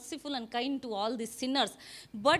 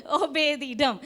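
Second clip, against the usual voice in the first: laughing; 5 dB louder than usual. Real, not acted. Laughing while naming hero.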